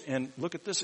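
ordinary talk

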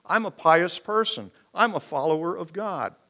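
high, loud show-off voice; showy boasting. Retold or imitated. A showy pious man loudly boasting of his goodness. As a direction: brag it to the crowd, loud and high. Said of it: "just screaming out in neon".

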